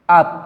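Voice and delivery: আত said as at with a stopped final t; Korean syllable demo. Shown as a demonstration. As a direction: model আত with the t held, not released. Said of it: "তাহলে হবে".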